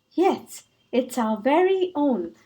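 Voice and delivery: usual speaking voice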